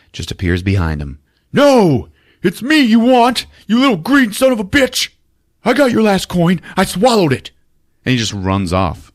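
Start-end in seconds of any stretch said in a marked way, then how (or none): 1.5-7.5 high, heroic challenge, hammed up; mock defiance. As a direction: taunt the monster high and forceful. Acts out Francis defying leprechaun.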